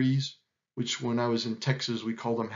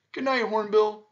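higher-pitched sleepy goodnight; yawning; 7 semitones higher, 3 dB louder than usual. A narrator's reading. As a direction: yawn out a goodnight. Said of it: "yawned his friends".

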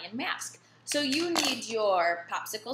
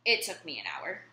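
ordinary delivery

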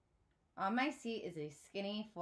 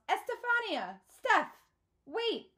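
high, loud calling after her; yell; 13 semitones higher, 8 dB louder, slower than usual. A narrator's reading. Father calling after his fleeing daughter, yelling. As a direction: yell after a running child. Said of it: "Poppy yells".

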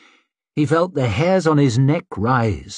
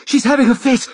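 high, breathless panicked cry; wheezed out in panic; 13 semitones higher, 4 dB louder, faster than usual. Acted. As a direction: gasp it out, winded and scared. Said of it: "he wheezed out".

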